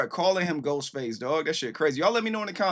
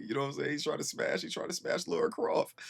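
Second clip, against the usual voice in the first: softer, whispered joke; whispered aside; 2 semitones higher, 5 dB quieter than usual. Real, not acted. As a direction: whisper it like a secret. Whispered aside about the lyric.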